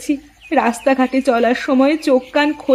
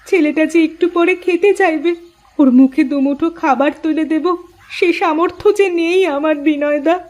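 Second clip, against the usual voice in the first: acted weeping plea, ending in sobs; tearful despair. Mother with no food for her child, crying. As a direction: plead through tears and break down. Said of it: "তুমি কান্নাকাটি করো না".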